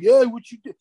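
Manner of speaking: loud, high, brassy street-tough greeting; brash Italian girl. Retold or imitated. Italian girl sizing up Tony on the street, loud and brassy. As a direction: bark it like a Chicago neighborhood girl. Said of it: "with a couple of Italian girls that are like".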